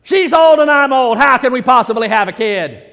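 loud, incredulous protest; role-played disbelief. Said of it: "We're playing a role here".

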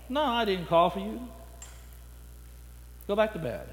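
put-on an old man half asleep waving him off; sleepy old man. Eli tells the boy to go back to bed, soft and drowsy.

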